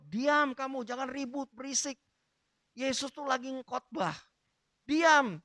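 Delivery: high-pitched telling-off; acted scolding. Followers of Jesus rebuking the noisy beggar, high-pitched scolding. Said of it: "orang-orang yang sedang mengikut Yesus menegur".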